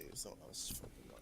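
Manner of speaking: low whispered confession; whispered aside. Real, not acted. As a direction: whisper it like a secret.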